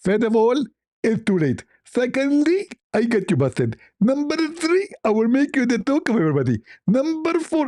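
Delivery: high, sing-out caricature counting off threats; hammed-up gloating in a put-on high voice; Zakir Naik. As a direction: squeal each numbered point triumphantly. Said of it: "Zakir Naik, don't count".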